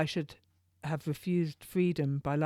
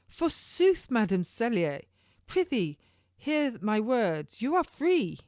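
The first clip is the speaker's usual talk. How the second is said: put-on much higher, louder young jailer; turnkey's voice; 6 semitones higher, 4 dB louder than usual. Young turnkey telling Madam Cellier she is free, high and loud. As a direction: announce it grandly, slowing on hear my words. Turnkey announces her release.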